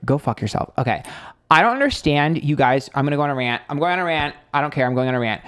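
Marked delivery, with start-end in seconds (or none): none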